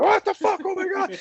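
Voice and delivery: very high, loud comic shriek; high-pitched mock terror; retold panicked scream. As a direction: scream it out as if the plane is going down. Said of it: "and I start screaming".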